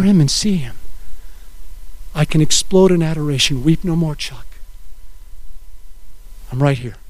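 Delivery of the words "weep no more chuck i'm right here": soft, lower, fading to a hush; gentle reassurance, close and quiet; tender comfort. Retold or imitated. God comforting Chuck in his private worship, soft tender voice.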